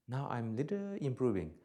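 usual speaking voice

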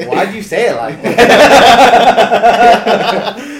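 high, loud laughing question; laughing disbelief. Retold or imitated. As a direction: crack up asking why. Own incredulous reply, laughing.

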